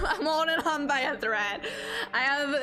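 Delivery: shaky laughter through the words; laughing. Real, not acted. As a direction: laugh it out, barely holding on. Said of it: "in case I start crying".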